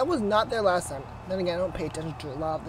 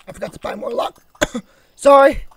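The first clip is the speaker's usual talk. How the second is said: raspy, sick-sounding voice; hoarse from a sore throat; 5 semitones higher, 17 dB louder than usual. Real, not acted. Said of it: "Sorry for my raspy voice".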